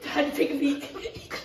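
loud laughter through words; laughing loudly. Real, not acted. Laughing about stinky feet.

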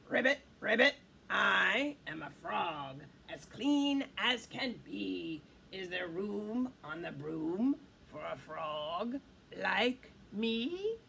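croaking frog. Acted. Frog asking the witch for a ride, croaking. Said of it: "then said with a croak".

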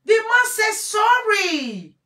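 slow, very high and loud; emphatic shout. Real, not acted. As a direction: hammer the point home. Insisting to audience.

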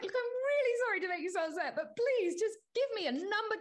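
high, loud, fast flustered apology; high flustered plea; frantic pleading. Retold or imitated. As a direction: beg in a panicked high voice.

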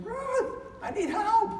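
high pained cry to the wife; screaming for help. Retold or imitated. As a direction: yell from the basement in pain. Herniated disc, screaming for wife.

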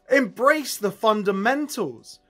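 ordinary talk

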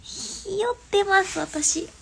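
high, slow, embarrassed admission; squeaky confession. Real, not acted. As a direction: squeal it out, a bit shy. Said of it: "ひよって載せられない".

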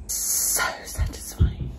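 slow, contented whisper; drawn-out pleased whisper; satisfied whisper. Real, not acted. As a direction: breathe it out, savouring the clean kitchen. Savouring a clean kitchen.

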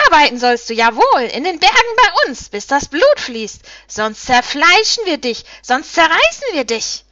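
loud, menacing harpy voice with high swoops; screeching harpy. A narrator's reading. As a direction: threaten your prey at full screech. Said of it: "Kreischend und hohnlachend landete die Trude".

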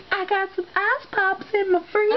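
high goofy put-on voice; silly high voice. Joking silly voice.

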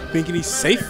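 normal talking voice

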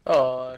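loud startled shout; startled yell. Real, not acted. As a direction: cry out when hit. Hit during gunfight.

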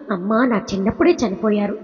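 ordinary delivery

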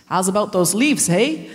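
first, slightly off try at a Canadian accent; a loose Canadian lilt, not quite landed. Shown as a demonstration. Doing the elders' Canadian accent, first try, a touch off. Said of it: "No, I haven't said that right".

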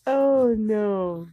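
normal talking voice

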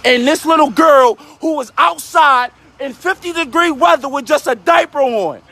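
real outraged shout; angry yelling. Real, not acted. Man protests in front of children about a girl in a diaper, angry yelling. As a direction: yell it emphatically at the onlookers. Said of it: "Yes, I'm yelling in front of these".

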